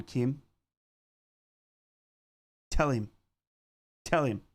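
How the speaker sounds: ordinary talk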